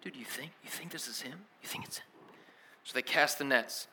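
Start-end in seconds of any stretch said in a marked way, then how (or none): 0.0-2.1 hushed aside between friends; very soft, secretive questioning; conspiratorial whisper. Retold or imitated. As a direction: whisper it so the stranger can't hear. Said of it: "looking at each other like".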